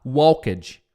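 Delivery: "walked" in two syllables, like "wal-kedge"; students' mispronunciation. Shown as a demonstration. Teacher imitating most English students, "walked" as "wal-kedge". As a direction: say "walked" wrongly, with L and a full "ked-ji" ending. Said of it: "noventa por cento dos estudantes de inglês vai falar".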